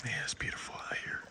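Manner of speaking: soft unvoiced whisper. Real, not acted. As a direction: breathe the words in awe. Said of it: "the more I have to whisper".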